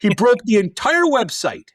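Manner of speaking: high, loud mock outrage; playful indignant punchline; comic exasperation. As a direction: land the disaster with incredulous emphasis. Repeats outraged punchline amid laughter.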